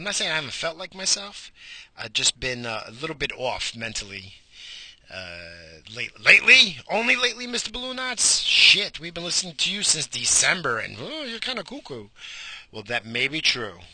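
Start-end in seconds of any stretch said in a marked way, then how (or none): 5.9-12.1 high mocking listener voice; mock heckler. Imagined listener teasing him, high and mocking. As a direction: sneer it like a snarky fan.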